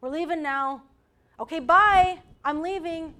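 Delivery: put-on loud exasperated calls to a child, climbing to a near shout; an upset mother calling her son off the slide; upset mom yelling. Speaker acting an upset mom yelling at her son Ollie, loud and fed up. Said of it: "Mom got upset and said".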